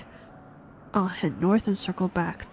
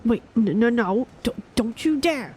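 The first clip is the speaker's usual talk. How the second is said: ordinary talk, nothing marked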